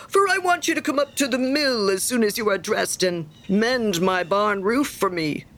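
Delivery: emphatic, bossy friend handing out a chore; self-important miller. Acted. Miller sending Hans to mend his barn, bossy. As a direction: give the order as if it were a favour. Voices the miller giving orders.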